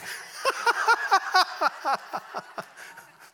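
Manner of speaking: high unvoiced burst of laughter; delighted laugh; big laugh. Real, not acted. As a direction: laugh out loud at the offer. Laughing at ride offer.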